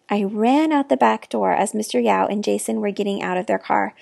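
plain reading voice

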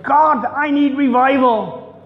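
high, loud pleading cry, slow; crying out to God. Retold or imitated. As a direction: plead aloud for revival from the gut. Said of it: "she cried out to God and said".

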